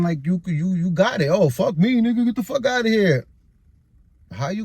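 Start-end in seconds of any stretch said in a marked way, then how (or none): 1.3-2.2 higher, louder mock of her yelling; mock angry dismissal. Mocking her dismissive outburst. 2.2-3.3 mock angry dismissal. Mocking her dismissive outburst.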